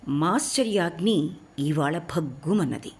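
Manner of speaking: plain reading voice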